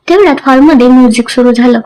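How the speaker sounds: usual speaking voice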